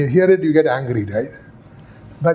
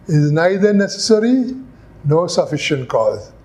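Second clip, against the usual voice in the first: usual speaking voice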